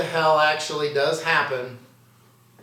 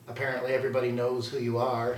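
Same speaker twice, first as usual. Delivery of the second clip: ordinary delivery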